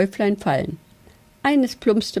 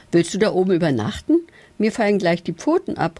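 plain reading voice

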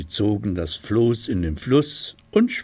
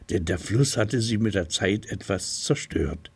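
plain reading voice

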